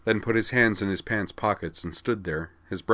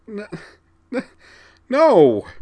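stammering wail, higher and louder; 11 dB louder, slower than usual. Acted. Vigdis, heartbroken, wailing at Corwin. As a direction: break down into a wail. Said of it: "she wailed".

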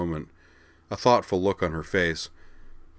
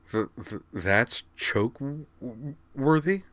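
slow, halting stammer, rising to a high question; offended; 6 semitones higher, slower than usual. A narrator's reading. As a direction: stutter it out in offended disbelief. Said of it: "sounding offended".

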